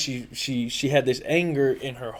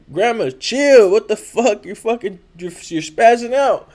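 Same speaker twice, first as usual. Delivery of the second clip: higher, louder, urgent plea to calm down; retold exasperated shout; 7 semitones higher, 8 dB louder than usual. Grandson reliving telling grandma to stop, exasperated shout.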